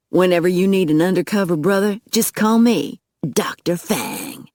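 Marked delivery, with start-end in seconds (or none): 3.4-4.5 slow, drawn-out dramatic name; stretched out for grand effect; self-styled title reveal. A narrator's reading. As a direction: announce your alias with slow swagger. Hero announces his nickname.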